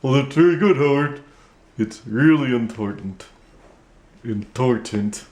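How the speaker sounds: ventriloquist dummy voice; puppet voice. Acted. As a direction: speak as the puppet. Puppet praises.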